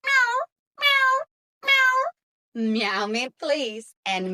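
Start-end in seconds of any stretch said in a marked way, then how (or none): 0.1-1.9 high, loud pleading meows; cat meowing. A narrator's reading. Cat looks at Katy.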